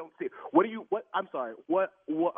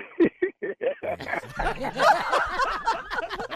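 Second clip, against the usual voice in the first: long laugh, higher than his talk; hearty laugh; 13 semitones higher than usual. Real, not acted. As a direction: laugh along at the joke. Victim laughs at the prank.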